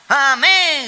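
high, loud mock "amen"; mimicked congregation shout. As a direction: imitate a whole church crying out. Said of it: "grita la iglesia". (grito imitado de la congregación)

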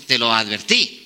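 low acted reproach; imitated knowing parent; parental I-told-you-so. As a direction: drop low, like a parent who warned them. Parents' answer to crying child.

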